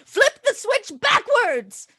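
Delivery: high, loud, drawn-out order; urgent command. Acted. Player's character orders the switch flipped, urgent shout. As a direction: call it out like a dramatic command.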